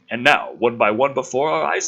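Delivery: ordinary talk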